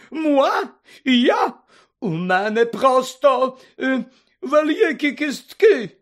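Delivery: high, loud, drawn-out indignant tirade; siren-like outrage. A narrator's reading. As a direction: wail in deeply offended fury. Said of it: "немов сирена".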